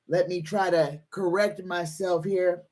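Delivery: normal talking voice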